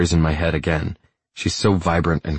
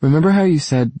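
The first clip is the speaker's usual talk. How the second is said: higher voice, tentative and a little strained; hesitant, serious woman building up to a confession; 7 semitones higher, 4 dB louder than usual. A narrator's reading. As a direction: ask it with mild strain. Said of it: "Her voice is serious, if not strained".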